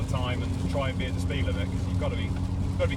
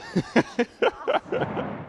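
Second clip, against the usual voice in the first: breathy high giggle; 11 semitones higher, 3 dB louder than usual. Real, not acted. Laughing at top of slide.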